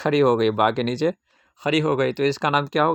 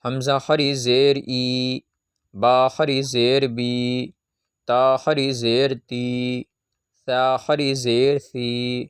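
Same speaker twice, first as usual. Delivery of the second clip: very slow syllable by syllable reading drill; slow chanted Qaida lesson reading; lesson recitation drill; much slower than usual. Shown as a demonstration. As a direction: spell each letter out slowly for children to repeat. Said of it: "سبق پڑھتے ہیں".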